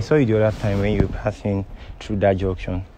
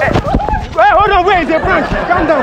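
very high, loud urgent cries; alarmed high-pitched pleading; panicked; 7 dB louder, slower than usual. Real, not acted. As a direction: frantically call off the confrontation. Prankster alarmed, reveals prank.

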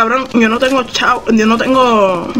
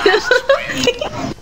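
kind gentle laugh; 13 semitones higher, 4 dB quieter than usual. Real, not acted. Thanking for gun.

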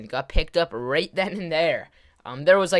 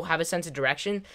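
ordinary talk

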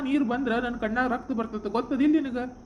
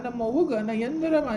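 ordinary delivery

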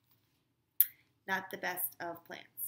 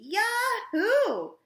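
high, much louder whoop of joy; gleeful yell; 14 dB louder than usual. A narrator's reading. Sherry cheers when elevators are announced, very loud high yell. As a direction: cheer it out at the top of your lungs. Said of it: "yelled Sherry".

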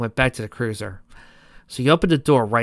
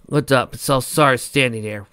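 ordinary delivery, nothing marked

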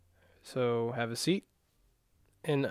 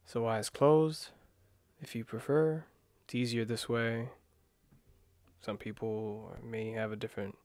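guided meditation. Acted. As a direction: ease them into calm breathing, gently.

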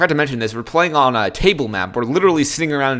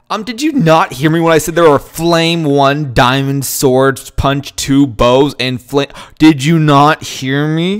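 loud and slow, spelling it out; did you not hear me, slow and loud; exasperated; 4 dB louder, slower than usual. Real, not acted. Repeating himself, exasperated.

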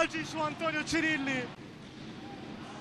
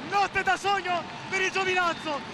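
breathless italian goal commentary; 3 semitones higher than usual. Real, not acted. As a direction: count down, then cheer the win. Final countdown and victory.

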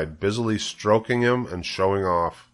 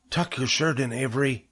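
high, fussing woman's voice; crying out; 5 semitones higher, 4 dB quieter than usual. A narrator's reading. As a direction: fuss at a boy about his clothes. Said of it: "cried Missus Zuckerman".

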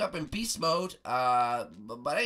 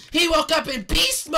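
higher, loud triumphant shout; excited shout; 9 semitones higher, 10 dB louder than usual. Acted. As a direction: yell out the answer in triumph. Epiphany, answering the riddle.

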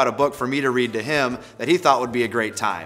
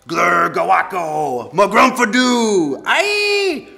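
slow, loud, climbing nonsense; hammed-up gibberish rising to a squeal; silly sounds; 7 semitones higher, 7 dB louder, much slower than usual. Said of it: "Make silly sounds like".

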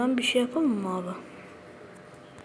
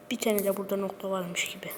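a soft aside in a whisper; 2 semitones lower, 6 dB quieter than usual. Real, not acted. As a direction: whisper it to yourself. Placing a block quietly.

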